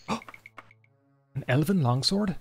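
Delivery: slow, awed gasp at the loot; gasping surprise. Real, not acted. Gamer gasping at a new sword. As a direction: marvel at the find.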